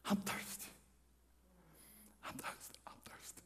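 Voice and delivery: faint breathless whisper fading away; weak whisper. Acted. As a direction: gasp it out, parched and dying. Dying Jesus on the cross.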